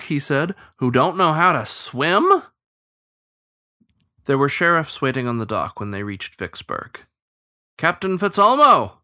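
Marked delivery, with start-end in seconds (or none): 0.8-2.4 baffled, higher and louder, rising question; utter bafflement. A narrator's reading. Beaver learning some folk can't swim, baffled. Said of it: "looked back at him with utter bafflement". 7.8-9.0 loud, high shout of hostile triumph; exultant hostile shout. A narrator's reading. Starling sheriff hailing the captain's boat, hostile exultant shout. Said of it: "The starling's shout".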